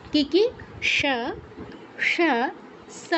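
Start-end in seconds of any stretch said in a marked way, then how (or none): 1.0-1.0 "স" as palatal sha; palatal sibilant. Shown as a demonstration. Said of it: "তিনটে স এর উচ্চারণ আমরা পেয়ে গেলাম". 2.1-2.1 "স" as retroflex ṣa; retroflex sibilant. Shown as a demonstration. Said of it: "তিনটে স এর উচ্চারণ আমরা পেয়ে গেলাম". 3.0-3.1 "স" as dental sa; dental sibilant. Shown as a demonstration. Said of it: "তিনটে স এর উচ্চারণ আমরা পেয়ে গেলাম".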